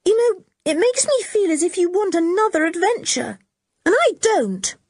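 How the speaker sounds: put-on high, unhappy girl's voice; unhappy Anne. A narrator's reading. Anne begs the boys to drop adventure talk, unhappy. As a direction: plead with him to stop.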